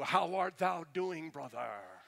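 slow, stately greeting; hammed-up archaic pomp, drawn out; mock King James speak. Greeting a friend at the mall, mock pompous. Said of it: "You don't talk to people like that".

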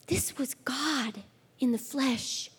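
slow, hushed whisper; awed whisper. Real, not acted. Preacher to the ladies about Jesus weeping, slow whisper. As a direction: let it land softly, in awe.

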